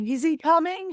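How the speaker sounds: retelling a breathless high question in labour; a strained high question, lightly acted; anxious plea. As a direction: ask it high and pleading, as if mid-push. Mid-labour question to the midwife.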